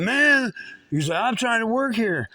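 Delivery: he's fed up being bothered; annoyed outburst. Retold or imitated. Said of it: "he just blew up".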